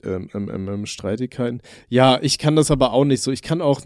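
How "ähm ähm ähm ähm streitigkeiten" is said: searching for words, fading; trailing off. Real, not acted. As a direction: lose the thread, voice sinking. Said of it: "ich hab vergessen, was ich sagen wollte".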